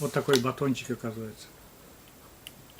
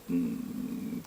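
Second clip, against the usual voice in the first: ordinary delivery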